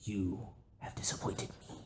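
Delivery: hushed, solemn whisper; whispered reproach. Pretend. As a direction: breathe the disappointment out. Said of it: "You just blurt it out".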